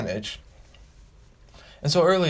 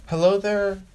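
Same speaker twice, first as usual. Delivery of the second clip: Hello there, lifted higher with bold confidence; confident greeting; 7 semitones higher than usual. Shown as a demonstration. Showing off the greeting to the audience, confidently. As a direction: announce Hello there like you own the room. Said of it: "shows your confidence".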